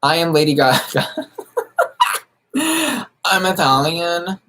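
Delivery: badly faked singer impression with a laugh. Streamer attempting a celebrity voice for viewers, laughing impression. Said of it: "I wish I could get her, her voice right".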